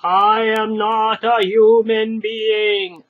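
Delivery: slow, loud, harsh monotone; slow harsh golem repeat; repeated golem declaration. Acted. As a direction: insist again, flatly and slow.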